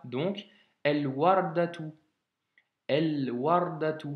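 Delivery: "el wardatu" with a clearly sounded L; slow, let the L ring in "el wardatu". Shown as a demonstration. Teacher reads "el wardatu" slowly to a student, L heard. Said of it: "on entend bien le L".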